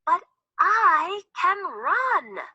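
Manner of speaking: yelled. Acted. Little Chick insists to Hen, a high yell. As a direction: shout it out bravely as the tiny chick. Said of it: "Little Chick yelled".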